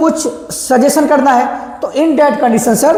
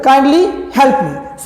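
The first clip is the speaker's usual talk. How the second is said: slow request; much slower than usual. Shown as a demonstration. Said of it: "kindly का भी इस्तेमाल".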